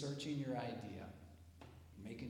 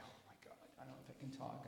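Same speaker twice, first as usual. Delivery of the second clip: nervous whisper; 8 dB quieter, faster than usual. Retold or imitated. Nervous kid about to present.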